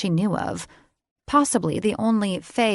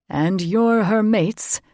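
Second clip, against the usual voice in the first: proud fae lord; 3 semitones higher, 5 dB louder, slower than usual. A narrator's reading. Father proudly introducing himself to his daughter, grand and loud. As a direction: puff up and declare it. Brain's voice.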